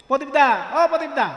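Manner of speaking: প্রদীপ said as পদীপ without the রফলা, calling out; dropping the r. Retold or imitated. Imitating a friend who never says the রফলা. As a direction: call পদীপ দা loudly without the r. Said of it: "সে প্রদীপ বলবে".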